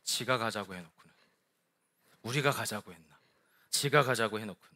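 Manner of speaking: low, soft resentful muttering; inner grumbling. Pretend. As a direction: grumble under your breath about the boss. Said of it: "저는 속으로 이렇게 얘기할걸요?".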